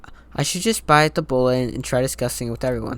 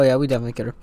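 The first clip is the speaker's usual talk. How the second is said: ordinary talk, nothing marked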